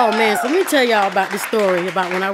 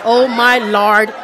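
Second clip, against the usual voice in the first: ordinary talk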